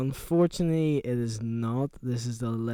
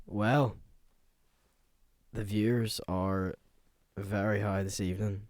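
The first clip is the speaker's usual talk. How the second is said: radio host; 4 semitones lower than usual. Acted. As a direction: hush it like a late-night DJ.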